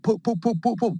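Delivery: quick high pop noises; high imitated pops. Voicing popcorn gunshots in the action scene, high popping sounds.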